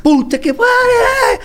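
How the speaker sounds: high, loud, slow cry of shock; retold startled shout. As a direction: yell it out like you nearly fainted. His shout when father revived.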